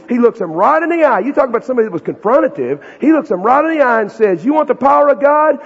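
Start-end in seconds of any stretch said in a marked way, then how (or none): 4.4-5.6 loud, high, in-your-face challenge; confrontational Jesus. Acted. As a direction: stare them down and hit it hard.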